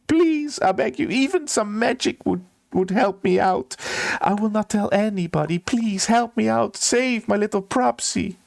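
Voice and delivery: high, pleading and desperate; begging steward. Acted. Steward begging the halfling for magic, high pleading. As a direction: plead with her to save the bird. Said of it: "And he begs you".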